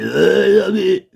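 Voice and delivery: high mock drawn-out noise; imitating former president. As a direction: put on a politician's odd cry. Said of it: "celui qui faisait".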